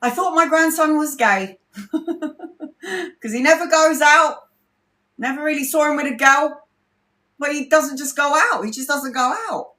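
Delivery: high, amused mum voice, laughing through it; imitating mum. Mum musing to the family about her grandson, high and amused. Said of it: "my mum was like".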